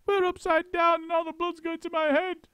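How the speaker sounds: put-on high, frantic old woman wail; screamed. A narrator's reading. Missus Twit panicking to husband. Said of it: "screamed Missus Twit".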